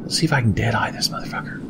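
fast sneaky whisper. Real, not acted. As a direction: hush it while aiming. Lining up a sneaky shot.

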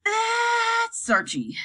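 put-on girl answers the troll briefly; curt reply. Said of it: "I said without explanation".

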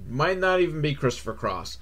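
ordinary talk, nothing marked

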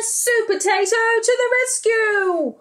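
high excited hero call; heroic announcement. Acted. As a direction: announce the hero's arrival.

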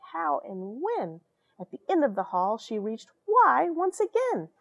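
lively read-aloud storytelling; expressive storybook voice; story narration. A narrator's reading. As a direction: read it like a bedtime picture book. Rhyming picture book read aloud.

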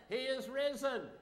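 loud, high call pushing for a response. Shown as a demonstration. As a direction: rouse them with a big shout. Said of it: "That is weak".

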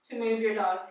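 usual speaking voice

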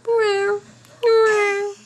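put-on a cute tiger roaring for a baby; squeaky high friendly roar. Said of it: "he looks like a friendly tiger".

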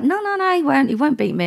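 high-pitched, dismissive no's coming down; mimicking a cocky dealer; imitated brush-off. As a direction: brush off the warning, pitched well up. Retold dealer brushing off warning.

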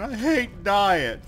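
high, drawn-out whine; long, high moan of frustration; whiny complaint. Real, not acted. Repeats complaint after dying in game.

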